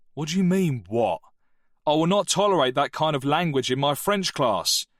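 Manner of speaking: angry teacher. Acted.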